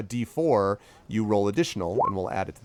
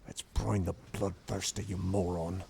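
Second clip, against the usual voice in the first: hushed urgent retort; 2 semitones lower, 8 dB quieter than usual. Acted. Crowd member hisses answer.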